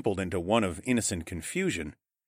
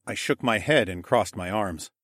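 plain reading voice

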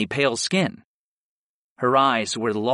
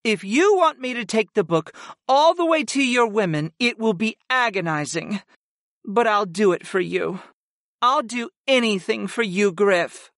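higher-pitched goddess, pained but willing; Sarah, devoted; 7 semitones higher than usual. A narrator's reading. Servant agreeing to an agonizing task for her master, devoted. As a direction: offer yourself up in a high character voice.

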